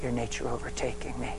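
soft, hushed prayer to the Spirit; quiet prayer. Acted. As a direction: pray it low and intimate. Prayer spoken softly.

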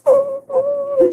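put-on high little "oh, oh" from the girl. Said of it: "And she's like".

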